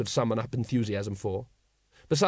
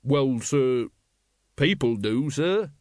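sulky; slower than usual. A narrator's reading. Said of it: "said the guard sulkily".

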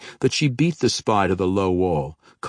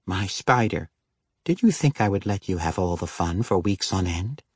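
playful, teasing woman's voice; teasing wife. Acted. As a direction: tease your husband lightly. Wife Denala teasing Regis.

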